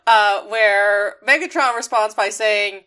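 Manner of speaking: ordinary talk, nothing marked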